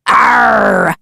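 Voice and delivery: short pirate arr shout; pirate growl. Acted. Pirate-like shell.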